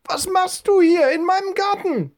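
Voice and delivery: put-on very high, scolding old lady voice; an old woman catching an intruder in her garden. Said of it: "rief eine Frauenstimme hinter ihm".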